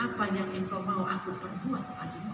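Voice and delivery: very soft, low offer; hushed, caring question rising at the end; gentle question. Acted. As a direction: ask tenderly, almost confidentially. Jesus gently asks the blind man.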